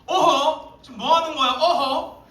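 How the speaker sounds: loud, high telling-off; scolding. Retold or imitated. Sister scolding her brother, loud and high. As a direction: scold him like a stern big sister. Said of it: "누나가 삼촌을 혼냅니다".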